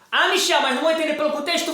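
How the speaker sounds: objeção de aluno imitada. Retold or imitated. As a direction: lightly mimic a protesting student. Voices a student's objection. (aluno reclamando, imitado)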